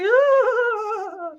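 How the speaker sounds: very high mock squeal; mock excited squeal. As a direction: squeal it like a thrilled camper. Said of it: "She's like".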